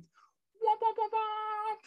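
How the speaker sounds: high, loud sung womp womp; womp womp sad trombone. Pretend. Mock sad trombone sound.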